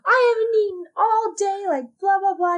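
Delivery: loud, high complaining rant; hangry outburst. Retold or imitated. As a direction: snap back hungry and upset. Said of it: "And I was like".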